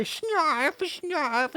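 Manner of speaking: high, whining nasal-free cartoon sidekick; whiny Snarf. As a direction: whine it out like a sniveling mascot. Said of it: "and he would say".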